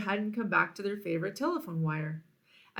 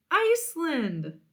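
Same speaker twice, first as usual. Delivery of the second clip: high, slow, wowed exclamation; amazed; 8 semitones higher, 3 dB louder, slower than usual. Acted. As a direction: savour the wonder of it.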